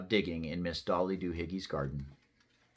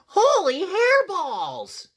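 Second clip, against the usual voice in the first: very high, slow, loud cry; amazed exclamation; 13 dB louder, much slower than usual. A narrator's reading. Skippy John checks his head in the mirror, exclaiming. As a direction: exclaim it big and drawn out. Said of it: "exclaimed Skippy John Jones".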